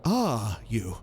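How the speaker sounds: tentative. A narrator's reading. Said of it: "I asked tentatively".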